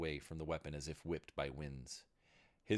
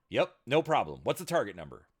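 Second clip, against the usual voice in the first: eager; 7 semitones higher, 10 dB louder than usual. A narrator's reading. Girl eager.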